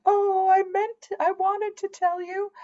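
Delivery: high, slow, whimpering mother voice; mock the mother's teary excuse, high and drawn out; imitating tearful mother. Mother caught out about the adoption, high tearful mock whimper. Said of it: "she starts crying".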